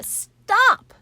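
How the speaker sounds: high, loud panicked scream. A narrator's reading. Wilbur stops the sheep's story with a loud, high scream. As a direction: cut her off with a shriek. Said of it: "screamed Wilbur".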